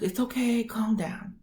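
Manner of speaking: soft, high reassuring coo; soothing. Retold or imitated. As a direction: hush the upset patient gently, imitating the doctor. Doctor soothing her.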